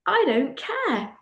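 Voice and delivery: mock defiant shrug of a line; defiant I don't care. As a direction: toss it off defiantly, drawn out. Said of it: "She went".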